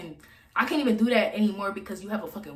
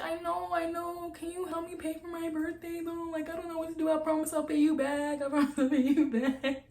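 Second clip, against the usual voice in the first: high, soft begging voice; fake pleading; 8 semitones higher, 5 dB quieter than usual. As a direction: put on a sweet helpless plea to get the money. Begging him for birthday money.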